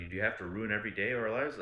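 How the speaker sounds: retelling a fight with his ex, fast fed-up mock complaint; retold exasperated accusation. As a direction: rattle it off, sick of the fighting.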